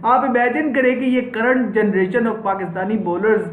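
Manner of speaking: ordinary talk, nothing marked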